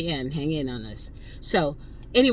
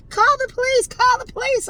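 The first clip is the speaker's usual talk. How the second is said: high shrill-free shrieking imitation, much higher and louder; mock screaming Karen; 5 dB louder than usual. Karen screaming at the driver to call the police, mock shrieking. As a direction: shriek it like a hysterical accuser. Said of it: "Oh my God".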